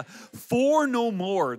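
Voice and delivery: drawn-out comic declaration; emphatic motto. Real, not acted. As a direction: proclaim it slowly as a joke slogan. Mock-solemn family motto about kids.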